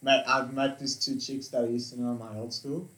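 usual speaking voice

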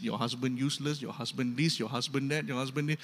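soft, low murmur of gossip; sowing seed quietly. Retold or imitated. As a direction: drip the poison softly into her ear. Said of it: "she's sowing seed".

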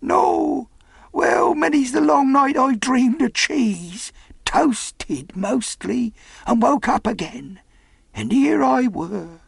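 high, slow castaway voice; castaway recounting his longings. Acted. Marooned sailor tells of dreaming of cheese, wistful character voice. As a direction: draw it out in a high-pitched old seadog character voice.

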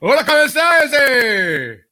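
high, loud hammed-up Spanish greeting; mock host greeting. Host greeting his co-host in a cheesy mock persona.

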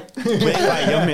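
high, drawn-out laughing repeat; amused, laughing agreement. Real, not acted. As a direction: echo the phrase slowly through a laugh. Laughing repeat of friend's phrase.

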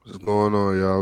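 usual speaking voice

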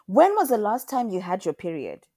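loud, urgent question falling away; anxious questioning. A narrator's reading. Mother asks daughter about her period, anxious questioning. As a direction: press the question with worry. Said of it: "pregnant with anxiety when she asked".

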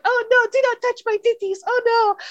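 high squeaky fake-coy protest; high mock-innocent refusal; mock girly protest. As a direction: ham up a coy girl pushing him off. Girl voiced refusing in retold scene.

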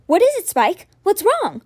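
high, loud frightened plea; panicked. A narrator's reading. Boy pleads with his dying whale, panicked. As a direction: alarm rising in his voice.

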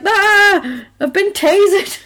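hammed-up high, loud cry of pain; comic, high-pitched outraged scream; mock shocked yelp. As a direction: yelp like a bad actor being zapped. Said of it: "But then Adam gets shocked".

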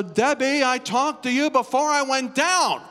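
high, loud, slow insisting; insistent high plea. Retold or imitated. Pastor insisting to Debbie on the phone, high and loud. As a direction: press her, drawn out and loud.

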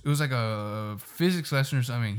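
usual speaking voice

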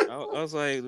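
ordinary delivery

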